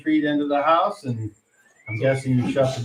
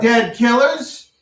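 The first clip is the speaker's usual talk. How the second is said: menacing sheriff; 8 semitones higher, slower than usual. Acted. Sheriff condemns party.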